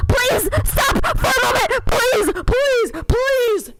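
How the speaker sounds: high, begging please; pleading prince. A narrator's reading. Prince begging Cinderella to stop, high. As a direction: plead desperately. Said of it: "said the prince".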